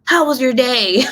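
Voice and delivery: high, strained mock-sweet greeting; mock upset greeting. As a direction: greet him on the brink of hysterics, hammed up. Seeing red, greets arriving husband.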